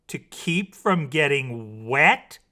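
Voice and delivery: slow, loud rising question; a touch of despair in the disbelief. A narrator's reading. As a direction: mermaid who loves water hearing of avoiding wet. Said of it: "the mermaid said despairingly".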